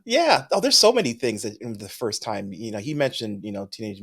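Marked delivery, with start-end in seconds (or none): 0.0-0.5 big burst of laughter; loud laugh. Real, not acted.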